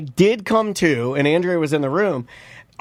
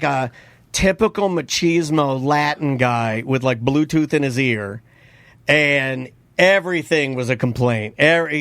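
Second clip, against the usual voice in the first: ordinary delivery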